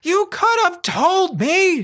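very high, drawn-out character squeal of reproach; outburst. A narrator's reading. Girl grabbing her friend and shaking him, high-pitched outburst. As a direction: let it burst out high, stretching the words. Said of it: "it was like a dam broke".

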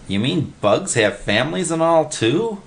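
high, simple-minded question; puzzled Willie. A narrator's reading. Willie asks Freddy about bug families, puzzled. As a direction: ask with naive surprise. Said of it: "Willie asked".

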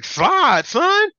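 high, loud yell at the quarterback to slide; mock yell. Yelling at Jaden Daniels to slide.

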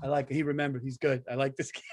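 low, quiet pleased murmur; Trump impression. Trump warming to Portnoy, low and quiet approval. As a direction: hum it low and soft, won over. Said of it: "And Trump's like".

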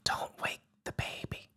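hushed, fully unvoiced whisper; secretive stage whisper. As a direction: whisper so the baby doesn't wake. Hushed bit about not waking baby.

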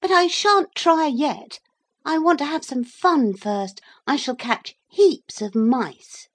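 high, loud, eager declaration; defiant boy turned cat. A narrator's reading. Maurice says he will have fun first, eagerly defiant. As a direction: announce your plans with cheeky relish.

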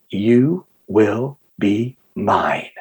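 deep, very slow, word by word; sinister demonic voice. Retold or imitated. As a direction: spell out a demon's claim, low and slow. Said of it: "very deep, sinister voice, very demonic".

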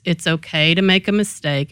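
usual speaking voice

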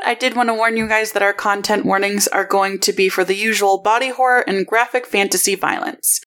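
normal talking voice

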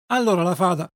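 plain reading voice

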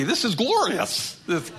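overjoyed bird basking in the warmth; happy little bird. Retold or imitated. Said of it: "he gets all happy".

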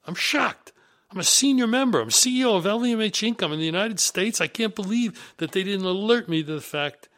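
high, loud indignant disbelief; shell-shocked. Retold or imitated. US chief learning of a departure from the newspaper, shocked outburst. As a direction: protest in outraged shock, pitch way up. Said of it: "I was shell-shocked".

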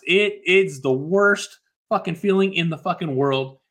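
usual speaking voice

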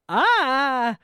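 short frightened yelp; startled scream. Acted. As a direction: jolt awake with a scream. Summer bolts up in fright.

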